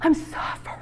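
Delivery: whispered mock lament; a sigh of mock suffering. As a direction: breathe it out as self-pity. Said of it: "We see it in the physical".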